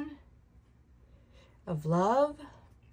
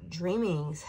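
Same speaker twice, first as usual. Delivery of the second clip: usual speaking voice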